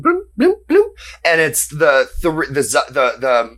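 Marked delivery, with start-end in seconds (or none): 0.0-0.9 high sing-song bloom of spinning reels; slot machine noise. Acted.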